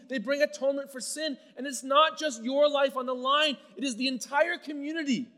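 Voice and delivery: furious. Acted. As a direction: shout him down in full fury. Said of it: "He is furious".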